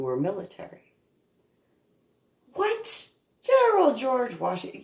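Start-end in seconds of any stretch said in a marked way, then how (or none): 2.5-4.8 very high-pitched disbelief; incredulous kid. Retold or imitated. Kid interrupting classmate's history lecture, high incredulous outburst. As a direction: blurt it in mock astonishment.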